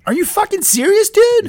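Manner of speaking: very high, incredulous exclamation; outraged disbelief. Acted. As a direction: squeal the question in shock. Host appalled at guest's drug use.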